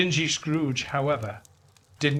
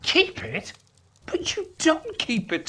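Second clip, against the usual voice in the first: incredulous; 14 semitones higher than usual. A narrator's reading. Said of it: "said Fred".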